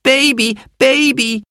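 put-on very high calling girl's voice; little girl following, calling out high. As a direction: call after the baby.

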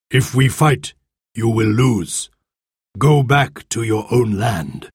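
slow, stern chief's warning; solemn chief. A narrator's reading. Golden Eagle orders the children home, stern. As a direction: warn them off with slow authority.

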